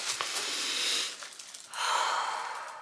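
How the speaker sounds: long slow exhale to reset; deep breath out. Real, not acted. Vlogger calming herself after talking too fast, long exhale. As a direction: breathe out slowly to calm down. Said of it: "take another deep breath".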